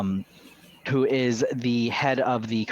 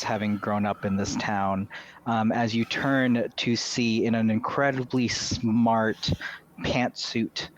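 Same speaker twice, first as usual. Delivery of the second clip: normal talking voice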